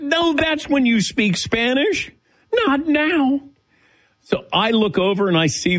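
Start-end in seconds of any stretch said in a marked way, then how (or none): 0.0-3.4 high, slow panicked plea; high mock-panicked protest. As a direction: plead in comic alarm, way up high. Panic at longer needle.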